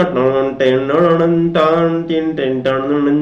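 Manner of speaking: droning "tan-tan-tin" instrument imitation; imitated theatre opening tune. Shown as a demonstration. Teacher showing students what plays as a drama begins, imitated opening tune. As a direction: mimic the music before a play, notes held steady. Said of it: "नाटक सुरू होताना ते लागतं ना".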